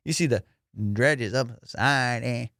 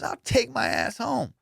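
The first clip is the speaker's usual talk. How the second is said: higher and slow, muttered again; repeated threat; 9 semitones higher than usual. Retold or imitated. Guard repeating his threat to quit, slower mock voice. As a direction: drag out I'll take my ass home, slower and less high. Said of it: "He goes".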